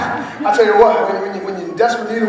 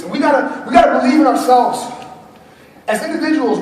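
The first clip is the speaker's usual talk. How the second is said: normal talking voice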